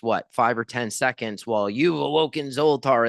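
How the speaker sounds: usual speaking voice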